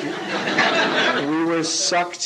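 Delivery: words broken by laughter, slowing down; laughing while talking. Real, not acted. Director amused by the stair cliché, laughing. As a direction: chuckle through the realization.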